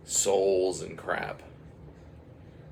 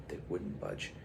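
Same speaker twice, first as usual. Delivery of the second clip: soft repeat; 6 semitones lower, 10 dB quieter than usual. A narrator's reading.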